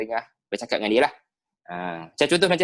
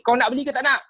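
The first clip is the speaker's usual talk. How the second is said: much higher, pushy challenge; rude intonation; 11 semitones higher, 4 dB louder than usual. Shown as a demonstration. Teacher shows how not to ask a customer, rude and high-pitched. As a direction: demand an answer, pitched right up. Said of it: "takkan kita tanya".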